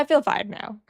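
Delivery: normal talking voice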